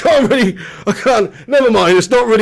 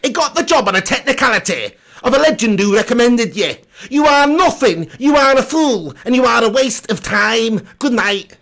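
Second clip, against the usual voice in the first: raised, indignant rant voice; Andy Tate; 3 semitones higher than usual. Acted. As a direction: pronounce a damning verdict, pitched up and emphatic. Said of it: "Do an Andy Tate impression".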